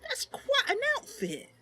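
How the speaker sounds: put-on high, excited young woman voice; Mercy gushing. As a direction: show off to your companion. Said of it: "and said".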